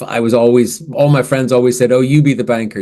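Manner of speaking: normal talking voice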